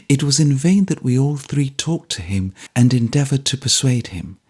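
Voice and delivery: plain reading voice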